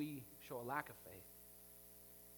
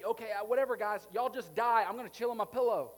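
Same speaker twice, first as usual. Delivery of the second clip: mock careless shrug; 5 semitones higher, 9 dB louder than usual.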